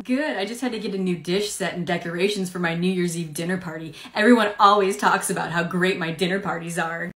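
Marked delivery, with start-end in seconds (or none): none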